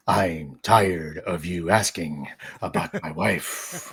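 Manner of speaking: low, slow crime boss grumble; weary menacing mob boss; Kingpin voice. Pretend. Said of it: "My man's like".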